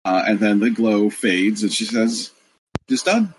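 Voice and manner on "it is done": goddess voice. Acted. Goddess speaks in GM scene.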